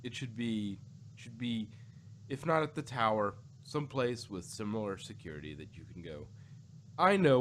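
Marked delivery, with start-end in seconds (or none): none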